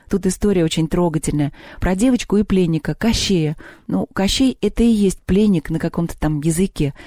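usual speaking voice

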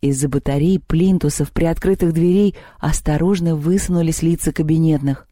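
ordinary delivery, nothing marked

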